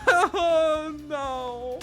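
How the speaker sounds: breaking into helpless laughter; laughing. Real, not acted. Breaks into laughter mid-line.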